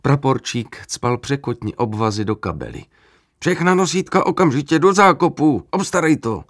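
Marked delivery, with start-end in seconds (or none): 3.4-6.4 urgent shout. A narrator's reading. Officer orders in battle.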